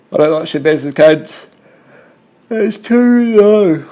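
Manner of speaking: loud, high outburst, last words drawn out; shouted complaint. Real, not acted. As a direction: yell it out, stretching the final words. Sudden yelled complaint during race commentary.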